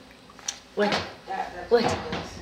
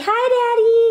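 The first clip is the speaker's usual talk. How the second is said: put-on high, louder baby-talk greeting; speaking for baby; 9 semitones higher, 6 dB louder than usual. Adult telling baby to greet Daddy, high baby talk. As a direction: pipe up on the baby's behalf. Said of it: "tell Daddy".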